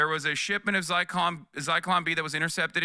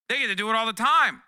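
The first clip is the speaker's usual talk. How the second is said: louder, higher, leaning on time; emphatic repeat; 5 semitones higher, 5 dB louder, faster than usual. Real, not acted. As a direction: hit it harder the second time. Repeated punchline, louder take.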